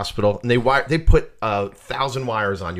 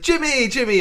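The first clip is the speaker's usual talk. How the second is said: high excited calling of names; 12 semitones higher than usual. Retold or imitated. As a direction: call out his name like a crowd. Crowd calling his name.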